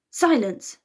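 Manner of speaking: loud sharp shout. A narrator's reading. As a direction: silence the room. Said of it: "shouted Pisces".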